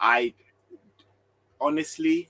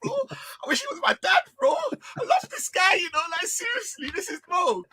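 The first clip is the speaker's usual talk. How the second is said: mock lovestruck fan; 12 semitones higher than usual. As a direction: squeal it like a smitten fan, mocking him. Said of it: "this is the type of chat man was giving you".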